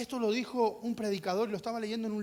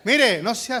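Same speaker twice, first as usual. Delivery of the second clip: usual speaking voice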